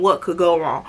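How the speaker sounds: low, dismissive echo of the question; scornful echo. Acted. Storyteller scolds the naive question, scornfully echoing it. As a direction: throw the question back in a dropped pitch. What you mean.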